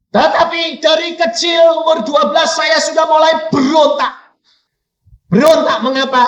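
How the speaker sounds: high, forceful preacher's shout; shouted testimony. Real, not acted. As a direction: cry it out from the pulpit.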